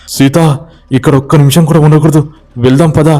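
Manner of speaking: high, fast urgent call. Acted. Ramu telling Sita to run, high and fast.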